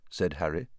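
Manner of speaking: ordinary delivery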